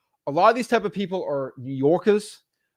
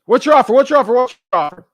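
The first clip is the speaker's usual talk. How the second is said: loud, high, repeated demand; screaming seller; 8 semitones higher, 11 dB louder, faster than usual. Retold or imitated. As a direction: shout it at the caller. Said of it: "when someone screams that at me".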